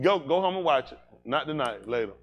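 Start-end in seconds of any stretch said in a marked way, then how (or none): none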